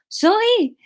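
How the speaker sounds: high, hammed-up French accent; high-pitched put-on French accent. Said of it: "we'll go French".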